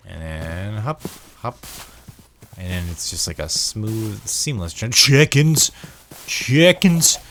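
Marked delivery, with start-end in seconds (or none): 4.9-7.2 loud, high burst at a sudden sighting; excited cry. Real, not acted. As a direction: cut yourself off and cry out in delight. Suddenly spots chickens in the game.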